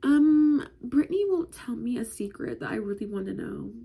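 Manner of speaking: loud, high whiny complaint; tattling kid. Retold or imitated. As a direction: tattle like a small child. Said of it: "so I was like".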